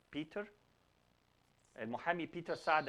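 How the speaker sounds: ordinary talk, nothing marked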